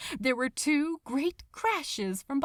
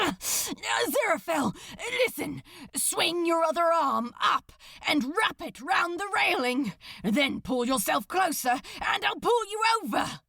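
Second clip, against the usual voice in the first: urgent instructions; 6 semitones higher than usual. Acted. Crowley instructs Aziraphale how to climb over, urgent. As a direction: drive each step home urgently. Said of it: "Crowley instructed urgently".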